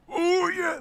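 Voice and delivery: very high strained wrestler cry; drawn-out hyped catchphrase; Macho Man. Retold or imitated. Fan throwing in a catchphrase, high hyped impression. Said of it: "the Macho Man's".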